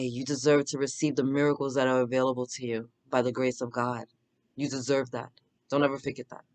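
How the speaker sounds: hushed spiritual sign-off; soft blessing. Acted. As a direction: bless them gently. Closing blessing to viewers.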